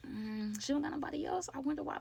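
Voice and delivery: hushed little voice of doubt; suspicious inner voice. Retold or imitated. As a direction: say it under your breath, suspicious.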